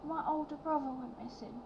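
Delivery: put-on high, soft small-child voice; a shy little boy introducing himself. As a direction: youngest child telling the fish lady about his brother, high and soft. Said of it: "he'll go to you".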